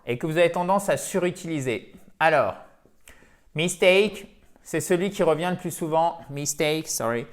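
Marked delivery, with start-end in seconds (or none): none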